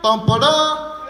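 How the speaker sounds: high, loud, slow heroic rescue line; heroic Superman voice. Retold or imitated. Strongman hauling him from burning building, heroic voice. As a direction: boom it out like a caped hero saving the day. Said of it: "was like".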